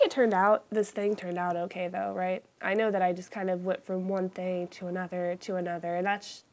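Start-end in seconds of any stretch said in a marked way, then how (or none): none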